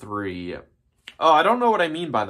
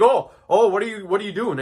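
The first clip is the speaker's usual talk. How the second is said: startled mock; 6 semitones higher, 3 dB louder than usual. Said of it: "and he's like".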